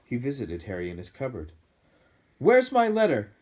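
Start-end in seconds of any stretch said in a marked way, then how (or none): 2.4-3.3 high loud demand. A narrator's reading. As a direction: confront him loudly. Harry confronts uncle.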